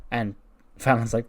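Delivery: normal talking voice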